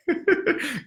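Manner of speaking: a quick high-pitched laugh. Real, not acted. Coach laughing after acting the awkward call. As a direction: crack up high. Laughs at his own skit.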